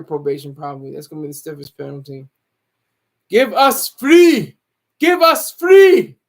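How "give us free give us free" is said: loud, high, drawn-out cry; Keith's pleading shout. Retold or imitated. Mocking what Keith is saying right now, slow high shout. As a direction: shout it slowly, stretching each word out. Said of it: "That's what Keith is saying".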